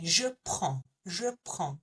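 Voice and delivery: je prends with a nasal ending, no D, mouth left open; French pronunciation. Shown as a demonstration. Teacher showing the class how to say je prends in French: open nasal vowel, no D. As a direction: let prends fade out open, no final D. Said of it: "Our mouth is left open at the end".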